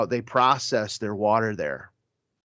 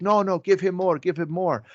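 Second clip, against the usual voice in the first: imitating the insistent bishop; 7 semitones higher than usual. The bishop teasingly urging the servers to give more food. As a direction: egg the servers on to pile it higher.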